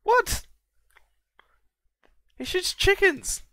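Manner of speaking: very high, loud delighted shriek; amazed shriek. Real, not acted. Seeing the Chicken Man attack, a high, loud delighted shriek. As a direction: squeal out in delighted shock.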